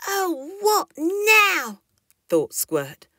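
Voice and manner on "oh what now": much higher tired groan; fed up and nervous in the dark; weary little dinosaur. A narrator's reading. Squirt hears rustling.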